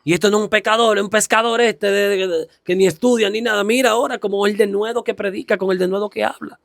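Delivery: astonished onlookers. Retold or imitated. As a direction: act out the townspeople marvelling at Peter. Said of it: "se sorprendieron esa gente que conocían a Pedro".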